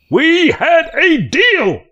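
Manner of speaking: high, loud, slow outraged holler; filthy man hollering at the boys. A narrator's reading. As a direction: stretch it out, hollering after the boat. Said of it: "the man hollered".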